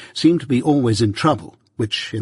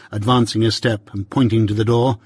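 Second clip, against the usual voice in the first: plain reading voice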